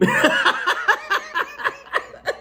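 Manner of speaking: high, stuttering belly laugh; wheezing laugh. Real, not acted. Storyteller cracking up over the nickname joke, wheezing laugh. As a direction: burst out cackling at your own punchline.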